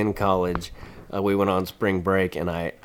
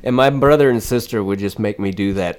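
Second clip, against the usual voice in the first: ordinary delivery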